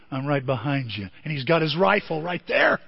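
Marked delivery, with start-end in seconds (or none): 0.1-1.1 a hushed threat from behind; soft, low and menacing; low menacing hero. Retold or imitated. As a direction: drop low and quiet, right at his ear. Hero whispering behind villain.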